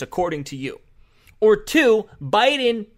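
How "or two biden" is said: raised, high and loud; louder punchy rant; loud emphatic. Real, not acted. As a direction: bark out option two. Host ranting at opponents.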